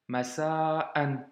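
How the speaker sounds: "masa'an" broken before "an"; careful demo of "masa'an" with a stop; hamza blocked. Shown as a demonstration. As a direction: hold "masa", catch it, then release "an".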